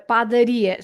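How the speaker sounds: "padaria" with the weight on RI; stress on ri. Shown as a demonstration. Teacher showing learners where "padaria" stresses, clear stress. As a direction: lean on RI in "padaria". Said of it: "So you stress the, that I".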